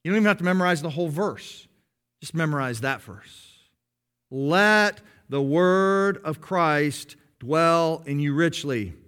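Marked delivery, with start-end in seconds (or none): 4.3-8.9 drawn-out, word by word; slow memorizing repetition; slow recitation. Shown as a demonstration. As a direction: say it slowly so it sticks. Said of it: "run that over and over".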